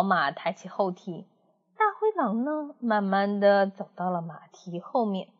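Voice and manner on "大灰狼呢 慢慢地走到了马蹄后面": plain reading voice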